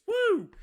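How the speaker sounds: high, loud "woo"; whoop. Real, not acted. Host cheering getting through a line, high loud whoop. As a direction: let out a sudden high whoop.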